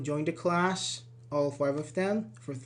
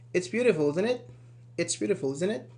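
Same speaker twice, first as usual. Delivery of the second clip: ordinary talk, nothing marked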